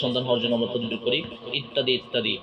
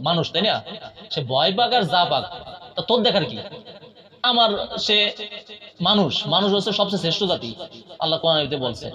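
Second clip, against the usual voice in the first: angry scolding; 7 semitones higher than usual. Acted. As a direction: berate the jinn harshly. Exorcist scolding the jinn.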